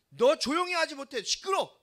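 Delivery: loud, high-pitched rebuke; scolding. Acted. Crowd hushing the blind beggar Bartimaeus, scolding loudly. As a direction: tell him off sharply at full voice. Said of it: "바디메오를 꾸짖어요".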